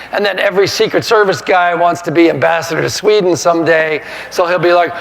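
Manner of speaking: usual speaking voice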